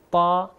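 loud, full-mouthed heavy ta; heavy emphatic ta. Shown as a demonstration. Teacher recalling the toe letter for learners, heavy emphatic ta. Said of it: "موٹا پڑا جاتا ہے".

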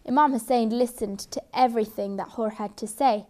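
usual speaking voice

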